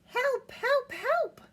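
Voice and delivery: very high cartoon calls for help; playful mock panic; little truck crying for help. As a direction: cry out like a tiny stuck truck. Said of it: "cried the little blue truck".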